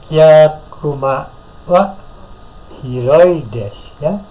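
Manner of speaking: each syllable drawn out slowly; slow demo. Shown as a demonstration. Teacher models "Yakuma wa hiroi desu" slowly for students. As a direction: say it slowly so the class can follow. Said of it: "Minasang dengarkan dulu".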